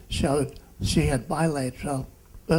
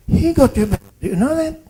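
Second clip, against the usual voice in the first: higher and louder, full of conviction; doctor stressing to a child that God healed her; emphatic; 10 semitones higher, 12 dB louder, faster than usual. Retold or imitated. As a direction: tell her with rising fervour.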